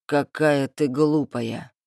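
low, slow, drawn-out villain voice; slow, low-pitched contempt; condescending thug. A narrator's reading. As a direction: drag it out low as a mocking thug. Thug leader mocks the captive.

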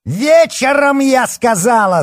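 very high, loud outburst; snapping angrily. Acted. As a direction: lose your temper and snap. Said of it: "сорвалась вдруг Оксана".

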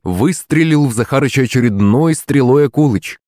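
ordinary delivery, nothing marked

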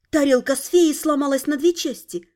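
plain reading voice

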